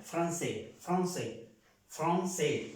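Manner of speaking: "Francés" said the French way, "fron-sé", final S silent; French nasal O. Shown as a demonstration. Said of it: "suena una O nasal". ("Francés" a la francesa, "fron-sé")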